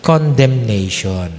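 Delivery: ordinary talk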